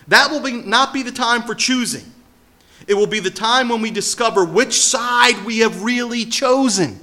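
rising, impassioned preacher's call; preaching at full force. Acted. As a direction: build it up from the pulpit, high and loud.

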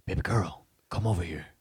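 low, soft come-hither voice; mock seductive lover. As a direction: play the smooth soap opera guy. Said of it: "A normal man does not talk like that".

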